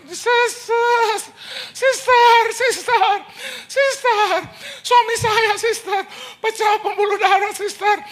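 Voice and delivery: high, frantic shrill-free falsetto of a frightened wife; frantic high wife on the phone; panicked wife. Retold or imitated. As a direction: squeal it out in a high panicked woman's voice. Panicked wife calling the preacher.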